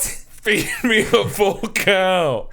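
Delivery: very slow, heavy giant demand. Pretend. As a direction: drag it out like a hungry giant. Playing a giant second grader.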